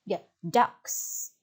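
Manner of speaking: normal talking voice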